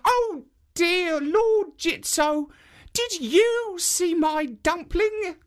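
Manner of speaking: put-on very high, slow old woman's plea; high, drawn-out old woman calling to the statue. A narrator's reading. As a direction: squeak it out like a frail old granny. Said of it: "asked it".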